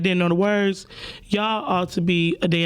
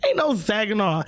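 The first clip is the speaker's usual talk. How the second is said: much higher, fast indignant correction; mock outrage; 8 semitones higher than usual. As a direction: snap back at her like the whole crowd is offended. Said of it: "We like".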